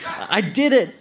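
high-pitched triumphant yell. Acted. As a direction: cry it out high, like a victory shout. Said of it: "I yelled".

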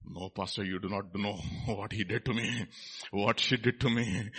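low, hushed aggrieved churchgoer; quiet mock grievance to the pastor; hurt refusal. Acts hurt churchgoer refusing to forgive.

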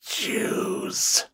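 eerie unvoiced whisper; creature whisper. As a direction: breathe it like a ghost.

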